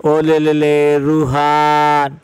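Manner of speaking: put-on drawn-out cooing, as to a baby; mock-coo very slowly, stretching each syllable; imitating someone fussing over a little child; mimicked baby talk. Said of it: "बच्चे का नाम भी ओ ले ले के साथ".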